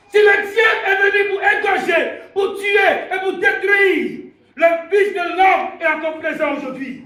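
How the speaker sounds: loud, high-pitched sermon cry; full-force preaching. Real, not acted. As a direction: proclaim it to the whole church. (prédication enflammée, criée)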